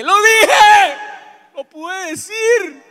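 very high, full-force scream; triumphant shout. Acted. Man finally admitting he needs help, triumphant scream. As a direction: yell it out like a victory. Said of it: "yo grité y dije".

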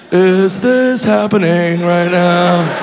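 slow, drawn-out disbelief; slow-motion starstruck wonder; stunned staring. Acted. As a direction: stretch each word as if staring in awe. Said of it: "I'm just staring at her like".

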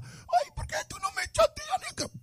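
squeaky, high whining woman's voice; whiny girlfriend. Pretend. Host voices girlfriend complaining he never texts, high whine. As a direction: play the clingy girl who feels ignored. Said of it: "voicing a whiny woman".

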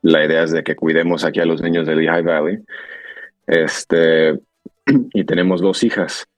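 ordinary delivery

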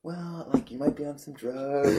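high, softened, careful voice; tentative retold suggestion. Retold careful suggestion to crying man.

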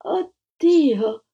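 a high, moaning gasp of fright; moaning cry. Acted. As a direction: cry out as you faint away. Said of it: "gemette la dama biancovestita".

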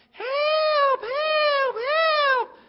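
high, loud, frantic yell for help; hammed-up cry of help from the monkey bars; kids shouting for help. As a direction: scream for help like a kid in peril. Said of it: "we started shouting".

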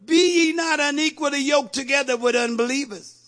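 loud, high, ringing verse; declaimed scripture. Real, not acted. Preacher repeats the verse to the congregation, declaimed. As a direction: proclaim the verse with preaching force.

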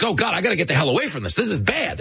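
panicky tourist. Retold or imitated. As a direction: rush it like you want out now. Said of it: "they're like".

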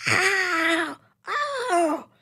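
high sharp yelps; yelping in pain. Acted. Doctor yelping as his chest is waxed, high pained yelps. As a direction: cry out as the wax rips. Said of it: "he yelped".

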